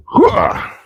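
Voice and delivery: high strained groan; effort grunt. Real, not acted. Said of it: "all the grunting and groaning".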